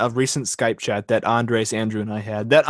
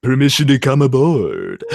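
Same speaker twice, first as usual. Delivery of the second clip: George Takei impression; 2 semitones lower, 3 dB louder than usual. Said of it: "I would also like to be George Takei".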